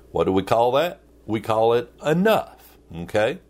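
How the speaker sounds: ordinary talk, nothing marked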